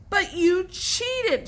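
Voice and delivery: high, slow, loud accusation; shouted. A narrator's reading. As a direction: shout it out in outrage. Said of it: "shouted Tom".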